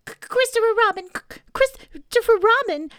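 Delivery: high, loud, stammered name; desperate cry. A narrator's reading. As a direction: call to your rescuer, stuttering with urgency. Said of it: "cried Piglet".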